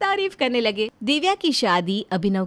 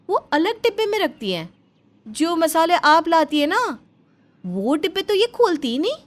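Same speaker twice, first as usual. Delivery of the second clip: put-on higher, conspiratorial young wife; suspicious daughter-in-law; 8 semitones higher, 4 dB louder than usual. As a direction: lean in and share a suspicion, voice pitched up. Komal confides suspicion to mother-in-law. (शक करती बहू, ऊँची आवाज़ में)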